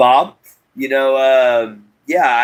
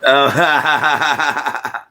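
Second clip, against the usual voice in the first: laughing; 5 semitones higher than usual. Real, not acted. Reacting to chat message.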